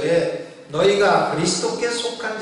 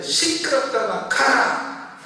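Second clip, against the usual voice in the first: retold shouted rebuke; 6 semitones higher, slower than usual. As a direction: shout it as if driving someone off. Retold rebuke to Satan's whisper.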